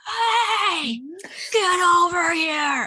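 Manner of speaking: high, slow, laughing yell; retold shout. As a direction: call the dog off, laughing. Yelling at dog attacking duck.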